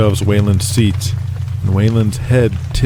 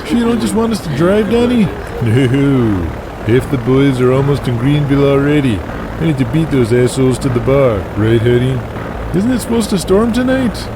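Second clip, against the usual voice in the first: high, lightly voiced young woman; a worried girlfriend asking, pitched up; 5 semitones higher than usual. Narrator voices Donnie's girlfriend.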